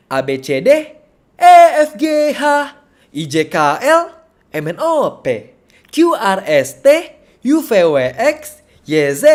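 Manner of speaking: "A, B, C" to "Y, Z" with playful swooping pitch; vary each letter group, some like "E, F" leaping high and loud; alphabet intonation drill. Shown as a demonstration. Teacher demonstrating creative intonation on the alphabet, playfully exaggerated. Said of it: "Sebagai contoh".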